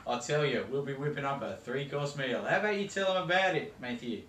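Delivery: broad ocker Australian accent played for laughs; hammed-up Aussie accent. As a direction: play a cartoon Aussie TV cook. Cooking with Aussie parody host.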